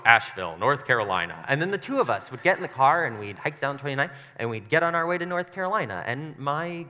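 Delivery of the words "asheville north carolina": low, loud, booming pronouncement; audible voice of Jesus. Acted. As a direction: announce it deep and loud. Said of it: "in an audible voice, Jesus would go".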